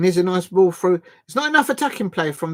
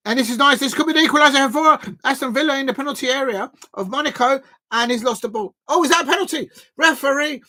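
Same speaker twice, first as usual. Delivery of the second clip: raised pitch, urgent live play-by-play; excited commentary; 7 semitones higher, 4 dB louder than usual. Real, not acted. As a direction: call the attack, then appeal to the referee. Live attack and penalty appeal.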